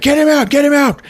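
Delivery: high, loud urgent commands; hammed-up commando yelling; mock shouted orders. Task force pulling patient from ambulance, mock urgent shouting.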